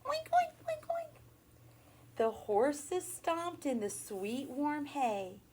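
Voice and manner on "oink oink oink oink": very high squealing oinks; pig squeal. Pretend. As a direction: squeal it like a little piglet. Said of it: "the little pig squealed".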